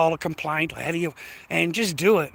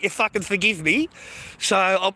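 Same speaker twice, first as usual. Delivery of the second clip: ordinary delivery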